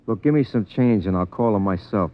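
normal talking voice

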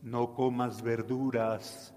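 devil voice. Acted. Devil tempting kids, low and soft. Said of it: "el demonio llega y te dice".

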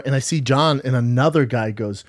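normal talking voice

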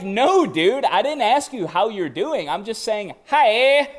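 loud, high-pitched mock protest; exasperated retort. Host setting straight someone who misread his greeting, mock exasperated.

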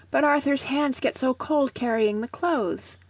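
put-on high, earnest child's voice; pleading child. A narrator's reading. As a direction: plead like a little boy. Boy pleading with his mother.

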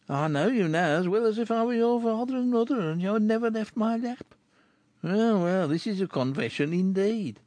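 a lightly voiced rough man; rough Chourineur. Chourineur teases the girl, lightly voiced rough man. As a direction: tease her like a rough friend.

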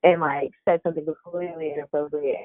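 normal talking voice

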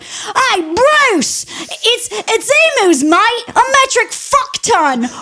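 high, loud Aussie yell to a neighbour; mock shout. Farmer shouting to his neighbour about emus, loud and high. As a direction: bellow a warning across the paddock. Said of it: "at the top of your lungs, you shout".

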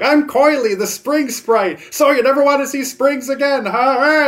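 high, loud cartoon creature voice; squeaky taunting little sprite; Coily the cartoon sprite. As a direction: pipe up like an old cartoon imp. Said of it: "little cartoon creature shows up".

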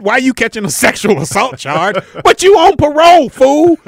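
loud, high scold; loud exasperated scolding. Acted. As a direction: chew him out for being reckless. Scolding a friend in the story.

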